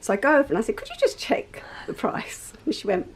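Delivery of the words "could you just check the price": breathy high whispered question; hushed high mock-timid ask. Retold polite request to shop assistant.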